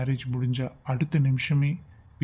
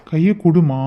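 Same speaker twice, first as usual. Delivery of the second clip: insistent demand; 2 semitones higher, 9 dB louder, slower than usual. Acted. Lover insists she give hand.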